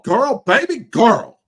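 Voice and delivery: loud, high, drawn-out mimicry; mock sassy outburst. Host mocking a congresswoman's outburst, loud sassy mimicry.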